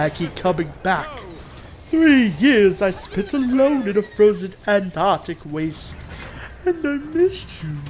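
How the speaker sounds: slow, gloating cartoon villain; pompous boss impression. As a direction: savour each taunt like a smug boss. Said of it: "doing an impression of the boss fight".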